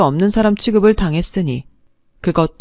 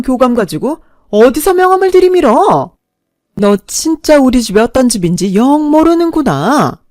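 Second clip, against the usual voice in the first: high, loud, mocking tirade; scornful outrage; 5 semitones higher, 6 dB louder than usual. Mother belittles girlfriend's parents, scornful outrage. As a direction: sneer with mock apology, then explode.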